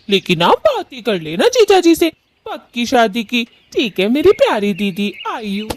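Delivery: put-on young Gauri voice.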